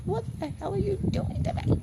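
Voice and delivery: high, loud mock yell at God; yelling at God in frustration, retold; retold angry yell. Speaker recalls screaming at God, mock yell. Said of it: "I screamed and yelled".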